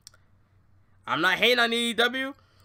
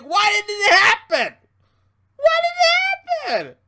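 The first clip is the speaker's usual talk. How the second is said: high, louder whiny mock voice; mock a whining wrestling fan, pitched up; 11 semitones higher, 6 dB louder than usual. Imitating disappointed fans, high whiny mock voice.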